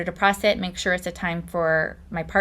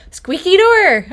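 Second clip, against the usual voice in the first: higher and louder, a playful punchline cry; mock dramatic exclamation; 10 semitones higher, 9 dB louder, slower than usual. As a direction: call it out like a comic headline, much higher and louder. Punchline exclaiming the squeaky door.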